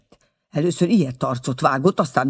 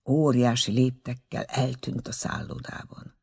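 ordinary delivery, nothing marked

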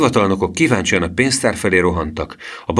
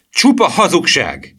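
high, loud, slow exclamation; drawn-out indignant shout; boy's outcry; 11 semitones higher, 5 dB louder, slower than usual. A narrator's reading. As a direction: cry out against the lie, drawing it out. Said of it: "kiáltotta Emil".

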